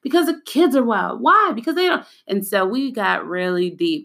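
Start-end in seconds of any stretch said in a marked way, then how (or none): 0.0-1.2 higher, whiny student voice; lightly voiced grumbling pupil; complaining student. Student answering teacher's whys. 1.2-1.5 much higher, louder why; teacher's insistent high why; pressing why. Retold or imitated. Teacher pressing student again. 1.6-2.1 higher, whiny student voice; lightly voiced grumbling pupil; complaining student. Student answering teacher's whys.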